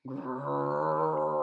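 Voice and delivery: low growl; bear growl. Acted. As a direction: growl like a bear creeping close. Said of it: "began to growl".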